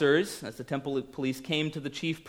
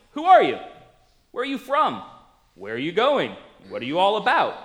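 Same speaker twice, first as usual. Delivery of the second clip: pitched-up, louder barrage of questions; a crowd pressing for answers, louder and higher; 4 semitones higher, 5 dB louder than usual. Retold or imitated. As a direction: fire the questions like a puzzled crowd. Crowd firing questions at Jesus.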